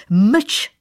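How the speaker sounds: loud, angry bark; barked order. A narrator's reading. Pale king silencing the astronomer, barked order. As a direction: bark it out, cutting him off. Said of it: "štěkl bledý král".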